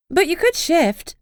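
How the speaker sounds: high, slow, otherworldly house voice. A narrator's reading. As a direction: speak slowly and high as a living house.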